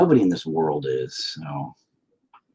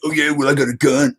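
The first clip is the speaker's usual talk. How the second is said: soldier; 6 semitones higher, 6 dB louder than usual. Pretend. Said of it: "They'd be like".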